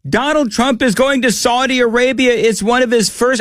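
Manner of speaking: high-pitched mock alarm; mock hand-wringing. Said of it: "a lot of hand wringing going on about".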